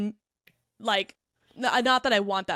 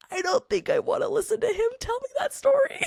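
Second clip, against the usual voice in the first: pitched way up, mocking the narrator; high mocking voice; 13 semitones higher than usual. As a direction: squeak it out in disbelief. Reacting to narrator's voice.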